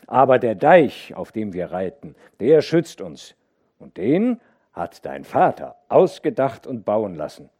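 mit Nachdruck, einzelne Wörter laut betont; ernst. A narrator's reading. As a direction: dem Kind ernst und bestimmt erklären. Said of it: "entgegnete Hauke ernst". (ernster Vater)

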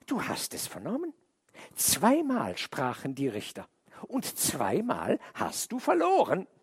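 high, quick snake reply with an odd timbre; cold snake voice. A narrator's reading. The snake tells the man he lost, high and quick. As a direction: coolly rub in his loss.

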